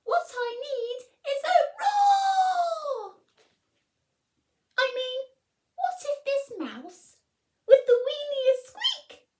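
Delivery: high, loud squeaky mouse voice; a tiny mouse dreaming of a roar; excited little mouse. Acted. Mouse plotting in bed, high squeaky and eager.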